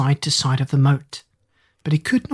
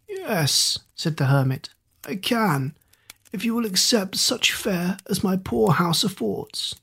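put-on old hermit; 3 semitones higher, 5 dB quieter than usual. A narrator's reading.

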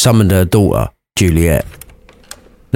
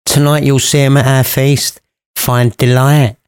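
mother's voice; 4 semitones higher than usual. Acted. Mother to Juliet.

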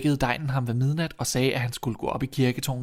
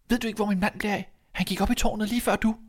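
high, anxious question; worried wife; 9 semitones higher, faster than usual. Acted. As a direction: ask urgently after a missing husband. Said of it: "Til sidst blev hun bange". (bekymret, høj kvindestemme)

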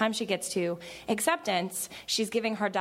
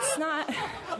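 higher, half-laughed retort; laughing reply; 9 semitones higher than usual. Retold or imitated. She answers her dad's email in a laughing, higher voice. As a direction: toss it off through a giggle. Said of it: "I was like".

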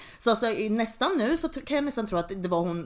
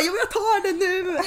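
put-on high, loud laughing boyfriend line; laughing retelling of the boyfriend insisting on buying it; laughing retold insistence; 10 semitones higher, 5 dB louder than usual.

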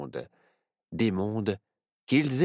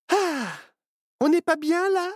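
much higher, joyful exclaim; delighted; 4 dB louder than usual. Acted. Myrtille delighted in the forest, high joyful voice. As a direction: savour the lovely forest. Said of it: "se réjouit Myrtille".

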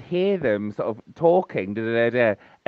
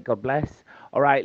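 ordinary delivery

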